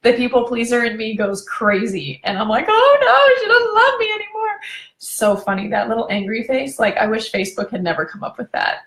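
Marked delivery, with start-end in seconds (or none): 2.7-4.6 high, loud mock whimper near tears; mock despair. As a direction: wail it like a hurt child, hammed up. Mock panic of people pleaser.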